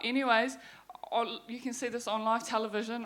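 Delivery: ordinary talk, nothing marked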